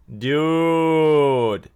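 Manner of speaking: excited exclamation. Real, not acted. Streamer calling out to his chat, excited. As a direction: call out to chat, fired up.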